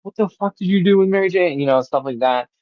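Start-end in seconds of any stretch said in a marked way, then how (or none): 0.0-1.6 high-pitched frantic demand; mock freak-out, pitch pushed up. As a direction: imitate a panicked, angry accusation. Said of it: "and he's freaking out".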